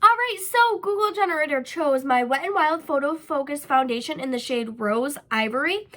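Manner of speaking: ordinary talk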